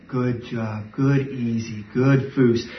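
low, soft praise to the dog; low gentle good-dog praise. Shown as a demonstration. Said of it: "I'm letting them know, "I'm not mad with you anymore".

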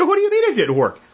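very high incredulous question. Retold or imitated. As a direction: ask in total disbelief. Friend says carrots failed.

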